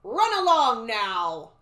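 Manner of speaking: loud, slow dismissive command; booming guard voice; stiff guard. A narrator's reading. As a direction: send them off as a stern sentry. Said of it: "he said stiffly".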